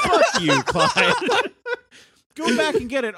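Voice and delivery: cackling high through the words; laughing. Acted. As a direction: laugh out a mock insult. Joking quarrel over lost bones.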